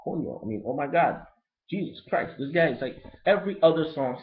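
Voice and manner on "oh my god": normal talking voice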